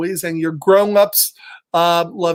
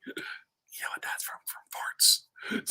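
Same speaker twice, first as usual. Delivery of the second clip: put-on conspiratorial whisper to the kids; hushed stage whisper about farts; 15 dB quieter, slower than usual. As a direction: lean in and whisper the secret. Confiding a gross secret.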